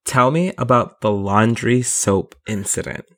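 slow, deliberate reading; slowed-down scripted question; scripted delivery. Shown as a demonstration. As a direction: read it out solemnly, word by word. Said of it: "say it just like this".

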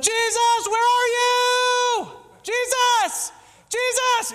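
high, loud desperate cry to Jesus; panicked shout. Retold or imitated. Panicked believer shouting for Jesus, loud and high. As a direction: call out frantically for Jesus, slow and stretched. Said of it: "and we're like".

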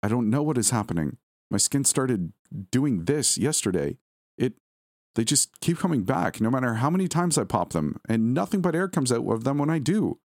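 agitated, frightened confession; angry fear. A narrator's reading. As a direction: let fear push the pitch up as you explain. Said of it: "anger and fear now very present in his voice".

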